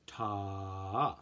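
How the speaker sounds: Ta held long and drawn out; stretched long note. Shown as a demonstration. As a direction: stretch Ta like bubble gum. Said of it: "Like we're stretching out the sound".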